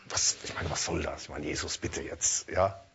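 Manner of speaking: mock exasperated aside. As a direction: grumble it under your breath at Jesus. Mock complaint to Jesus. (leiser, gespielt entrüsteter Einwand)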